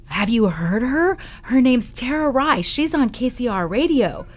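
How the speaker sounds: imitated fan's eager pitch; excited young fan. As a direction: gush like a kid naming a favorite host. Voicing the excited teenage fan.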